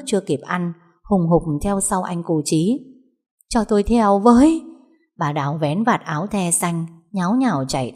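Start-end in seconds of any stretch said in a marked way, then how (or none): 3.5-4.6 urgent plea. A narrator's reading. Mother begs to follow.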